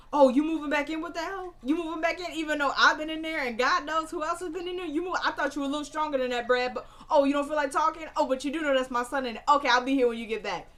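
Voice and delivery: high, loud mocking jeer; taunting. Man runs outside to needle Brad as he leaves, taunting. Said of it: "starts taunting him".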